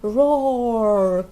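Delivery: cheerful mock roar, feeling better; dinosaur roar. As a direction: roar like a happy toddler's dinosaur. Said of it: "cries George".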